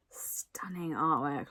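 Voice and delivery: hushed, slow whisper of awe. Real, not acted. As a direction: breathe it out in wonder. Admiring the artwork.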